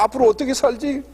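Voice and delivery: 울먹이며 한탄하는 아내 흉내; mock sobbing wife. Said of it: "이렇게 막 엉엉 우는 거야".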